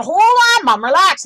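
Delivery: imitating an alarmed son, high and loud; urgent interjection. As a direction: cut in loudly to stop mom. Voices brother's alarmed interjection.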